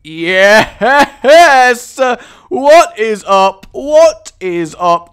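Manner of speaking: loud, high whoop of victory; triumphant shout. Real, not acted. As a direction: celebrate with slow, hollered words. Celebrates the item he got.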